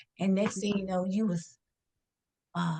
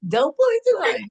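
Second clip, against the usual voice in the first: higher and louder, cracking up on "pull"; gleeful repeat; 12 semitones higher, 8 dB louder, faster than usual. Retold or imitated. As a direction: shout the punchline again through laughter. Repeats the punchline, laughing.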